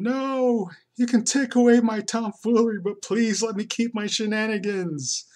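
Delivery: high, loud hammed-up wail; mock crying. Comic guy begging the stern boss in a hammed-up wail. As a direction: plead in a hammed-up sob. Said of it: "this guy's just crying".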